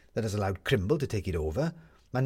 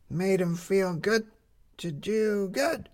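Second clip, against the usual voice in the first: put-on high, slow girl's voice; Lizzie; 9 semitones higher, much slower than usual. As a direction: savor the line slowly, high and young. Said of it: "said Lizzie".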